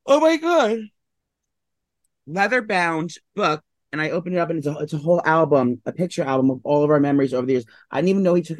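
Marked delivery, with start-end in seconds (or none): 0.1-3.6 very high squeal, then slow drawn-out words; overwhelmed by the sweet gift; gushing awe. Real, not acted.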